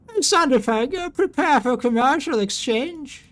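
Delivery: high, loud radio hail; hailing captain. Acted. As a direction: call across to the other ship. Captain hails the ship to trade.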